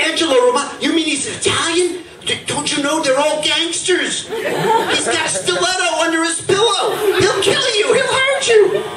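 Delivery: high, alarmed, rising panic; horrified mother. Retold or imitated. Shocked mother warning Shirley about Angelo, high and alarmed. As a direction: warn her daughter in growing horror. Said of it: "her mother's jaw, she said, just dropped over".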